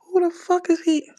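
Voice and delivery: very high-pitched squawk; exaggerated falsetto indignation; mock incredulous outburst. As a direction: squeal it in high disbelief. Mock outburst about the rapper.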